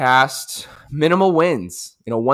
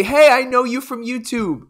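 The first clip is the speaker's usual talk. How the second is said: higher lightly voiced eager fan; a hint of an excited stranger; fan greeting; 12 semitones higher than usual. Fan recognizing the vlogger, lightly voiced higher. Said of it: "two people come up and say".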